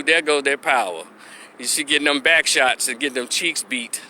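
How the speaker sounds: ordinary talk, nothing marked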